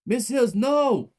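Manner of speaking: high, loud, slow anguished scream. A narrator's reading. Child to the dying teacher, anguished scream. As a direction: scream her name in anguish. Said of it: "I screamed".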